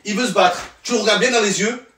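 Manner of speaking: high, eager hammed-up teacher; mock instructor. Comic playing an instructor teaching students, high and eager. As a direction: demonstrate like a cocky coach.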